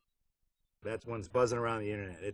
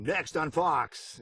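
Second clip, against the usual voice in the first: slow, higher promo-announcer voice; announcer read; 6 semitones higher, 4 dB louder, much slower than usual. Shown as a demonstration. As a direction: stretch it out like a network promo. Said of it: "you do your thing".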